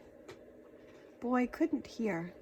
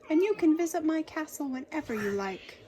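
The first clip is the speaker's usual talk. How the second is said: higher friendly king; 6 semitones higher, 4 dB louder, faster than usual. Acted. King inviting the dragon to visit, higher king voice. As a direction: welcome the dragon warmly.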